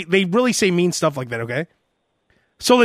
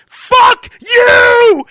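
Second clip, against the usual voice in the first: full scream, much louder and higher; 10 dB louder than usual. Retold or imitated. Caller screaming at the pushy telemarketer, full-throated yell. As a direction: scream it at him. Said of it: "and I screamed".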